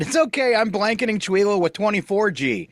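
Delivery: high, giggling fake-villain voice; mock sinister villain. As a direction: ham up a gloating schemer.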